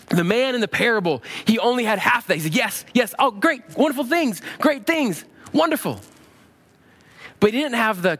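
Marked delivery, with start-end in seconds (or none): 2.5-6.0 high, eager gushing; delighted acceptance. Acted. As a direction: act out greedily welcoming good things. Voicing the man in the parable.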